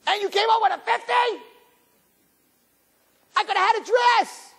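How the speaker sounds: very high, aggrieved mock shouting; hysterical scolding. Parent berating kid over tutor money, hysterical scolding. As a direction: rail like a martyred parent.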